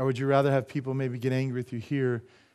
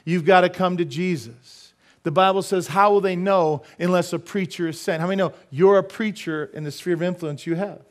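raised, urgent preaching voice; forceful preaching; 5 semitones higher, 6 dB louder than usual. Real, not acted. Preacher charging the congregation to speak up, forceful preaching. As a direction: press the congregation, higher and louder.

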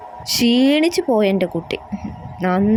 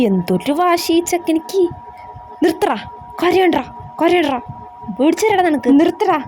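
put-on raised, scolding voice at a crying boy; grandma tells the crying grandson to stop, higher and scolding; 6 semitones higher than usual.

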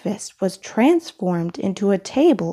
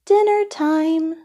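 higher, sing-song mother's call; calling to dinner; 8 semitones higher than usual. Acted. Midas's mother happily calls him to dinner, a higher sing-song. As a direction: call it out cheerfully like a mom.

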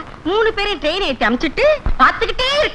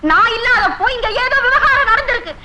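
high, insistent outburst; agitated demand; 5 semitones higher than usual. Acted. Suspicious wife demands truth about husband, high agitated outburst. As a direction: demand answers in a raised pitch.

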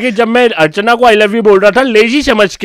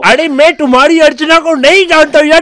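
raised, higher-pitched exasperation; exasperated protest; 6 semitones higher than usual. Pretend. Prank caller denies knowing the man's Archana, exasperated. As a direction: protest as if wrongly accused.

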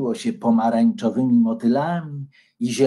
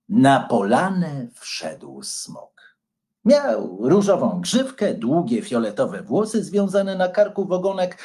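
usual speaking voice

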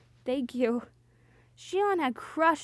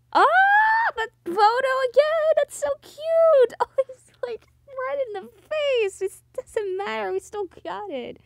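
high squeal of delight; real gushing; 11 semitones higher, 6 dB louder than usual. Real, not acted. Streamer delighted by the cute photo, real squealing excitement. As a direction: gush over it in a high, excited voice. Streamer gushes over game picture.

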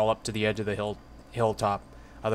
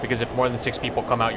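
ordinary talk, nothing marked

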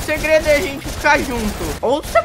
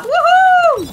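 high, loud cheer; excited cheer; 6 dB louder than usual. Real, not acted. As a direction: whoop with joy. Got the best weapon.

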